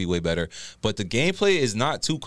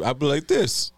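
high, electronic disguised voice, laughing; morphing voice; 7 semitones higher than usual. Shown as a demonstration. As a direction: voice changer pitched way up. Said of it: "With the voice changer and shit?".

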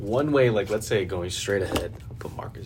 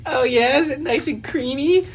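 much higher, louder teasing sing-song; mocking taunt; 7 dB louder than usual. Driver mocking the woman, high sarcastic taunt. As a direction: tease her with fake sweetness. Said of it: "he's like".

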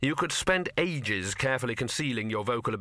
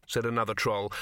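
ordinary delivery

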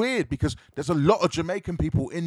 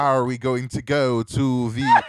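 slow, loud, over-enunciated mock Jamaican accent; bad Jamaican accent; 3 semitones lower, 4 dB louder, slower than usual. Host mocking an actor's attempt, bad Jamaican accent. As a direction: lampoon a laboured fake Jamaican accent, low and deliberate. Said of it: "try to do that Jamaican accent".

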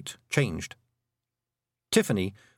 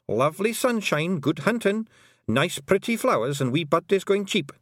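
high, lilting dialect voice listing delights; Rob Anybody, cheerful little Feegle; 8 semitones higher than usual. Acted. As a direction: rattle off the pleasures in a high voice.

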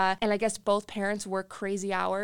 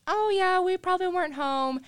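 mock owner; 10 semitones higher, 3 dB louder than usual. Owners answering casually.

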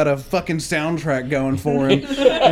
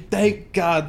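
usual speaking voice

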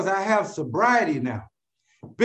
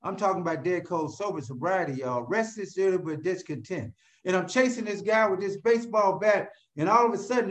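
ordinary delivery

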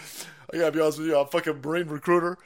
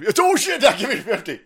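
loud shouted order, pitched up; drill sergeant bark; 8 semitones higher, 8 dB louder than usual. Pretend. Playing drill sergeant, shouting an order to do pushups.

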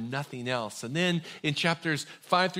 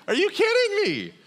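disbelief; 4 dB louder than usual. Retold or imitated. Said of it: "I was like".

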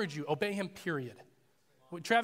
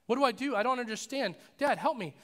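higher, louder acted panic of a son; panicked cry for help; 6 semitones higher, 5 dB louder than usual. Son crying out to Dad about a bee, acted panic. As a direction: play a boy freaking out, pleading urgently. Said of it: "he is hyperventilating, he's freaking out".

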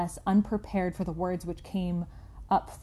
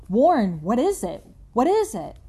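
louder, rising panicked calls; terrified calling; 4 semitones higher, 7 dB louder than usual. Acted. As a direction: call into the phone in terror. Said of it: "Terrified, I continued to repeat".

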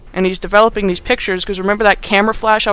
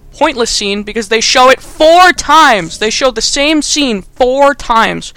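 slow, emphatic, higher-pitched rant; worked-up exasperation; 5 semitones higher, 5 dB louder, slower than usual. Real, not acted. Reviewer ranting about a repeated scene, exasperated. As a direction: hammer it out in disbelief. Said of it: "gotta chill out".

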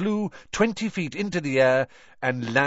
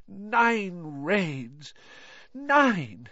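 exasperated exclaim; 10 semitones higher, much slower than usual. Acted. Tired father exclaiming.

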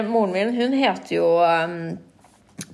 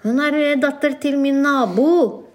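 raised mock mother voice, slowing down; higher, hammed-up mom denying her daughter; imitating mom; 7 semitones higher, 4 dB louder, slower than usual. Mom telling the lady at the shop, playful raised voice.

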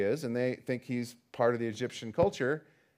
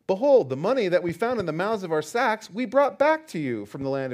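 earnest Bible reading, rising louder on the brothers' plea; scripture reading; 3 semitones higher, 4 dB louder than usual. Acted. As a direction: read the brothers' protest with building urgency. Reads scripture aloud.

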